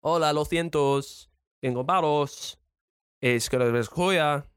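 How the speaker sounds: gibberish Spanish; mock Spanish, said confidently; fake Spanish. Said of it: "saying words that sound Spanish".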